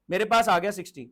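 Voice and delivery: usual speaking voice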